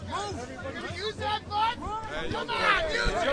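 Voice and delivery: high urgent yells; shouting. Real, not acted. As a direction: yell at the crowd. Protest clip shouting.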